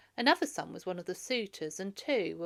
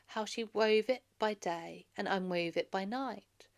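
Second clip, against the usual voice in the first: plain reading voice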